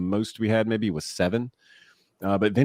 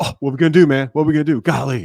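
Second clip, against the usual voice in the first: loud, high panicked outburst, imitated; freaking-out catcher; 5 semitones higher, 8 dB louder, faster than usual. Catcher to pitcher on the mound with bases loaded, imitated freak-out. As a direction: imitate a teammate losing it under pressure. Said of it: "he is freaking out".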